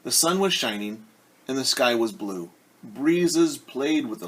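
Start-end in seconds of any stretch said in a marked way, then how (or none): none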